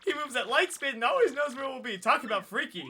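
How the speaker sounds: lively, high-pitched character rant; exasperated game character. Acted. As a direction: act fed up and amazed.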